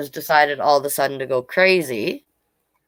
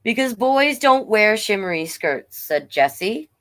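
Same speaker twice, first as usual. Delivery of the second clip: story narration; 5 semitones higher than usual. A narrator's reading. Reads picture book aloud.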